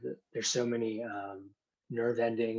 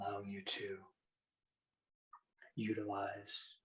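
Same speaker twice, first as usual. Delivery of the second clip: hypnosis induction; 6 dB quieter than usual. Acted. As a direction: guide them gently into trance.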